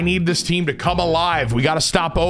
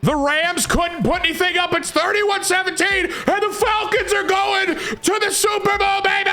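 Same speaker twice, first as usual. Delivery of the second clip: much higher, shouted victory call; ecstatic celebration; 13 semitones higher than usual. Real, not acted. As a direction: howl it out as the win is sealed. Clinching win to reach Super Bowl.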